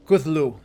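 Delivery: Cthulhu said as two syllables, "KUTH-loo"; crisp two-beat "KUTH-loo" for Cthulhu; corrected pronunciation. Shown as a demonstration. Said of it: "I've been saying it wrong, it's".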